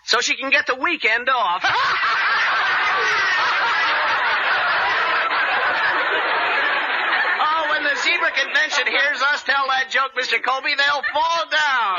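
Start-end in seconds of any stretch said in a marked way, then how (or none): 1.7-7.3 laughter. Real, not acted. Audience laughter after joke.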